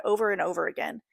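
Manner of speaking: ordinary delivery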